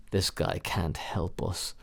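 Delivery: quietly write off the doctor; low, doubtful aside. Retold or imitated.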